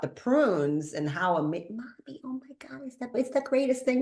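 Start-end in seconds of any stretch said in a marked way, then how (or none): 1.7-4.0 high, breathless half-whisper of joy; child's elation. Retold or imitated. Son to mother about prunes, high breathless glee. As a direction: gush like an overjoyed little boy. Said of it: "his overwhelm and joy and elation".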